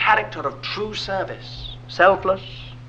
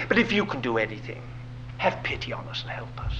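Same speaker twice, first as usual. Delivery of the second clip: quiet plea; 7 semitones lower, 8 dB quieter than usual. Retold or imitated. Father's plea quoted.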